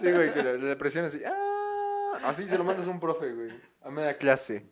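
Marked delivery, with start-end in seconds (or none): 1.2-2.2 mock moan. Imitating virus moan.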